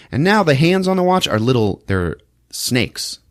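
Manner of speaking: normal talking voice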